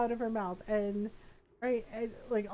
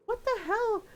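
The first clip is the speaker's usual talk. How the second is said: shocked; 10 semitones higher than usual. Retold or imitated. Steph shocked at baby's eating.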